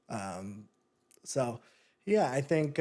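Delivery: ordinary talk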